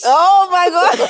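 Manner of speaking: loud laughter over the words; bursting out laughing. Real, not acted. Laughing at the question.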